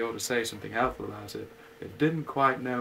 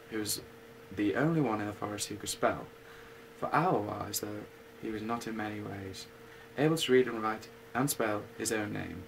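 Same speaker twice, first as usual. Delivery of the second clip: usual speaking voice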